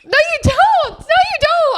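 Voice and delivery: very high-pitched, insistent denial; incredulous protest. Real, not acted. Friend disputing the hammock claim, incredulous protest. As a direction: refuse to believe it, voice shooting up.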